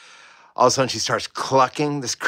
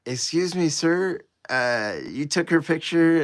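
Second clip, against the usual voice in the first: polite attendant; 6 semitones higher than usual. Retold or imitated. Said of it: "she's like".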